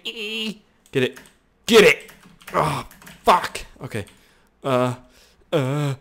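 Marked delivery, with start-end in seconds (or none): 0.0-0.6 squeak. Real, not acted. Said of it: "I sound like Mini-Me".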